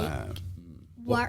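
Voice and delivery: imitating a friend's British accent; water bottle in an attempted British accent. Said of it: "can say, like, every word in British".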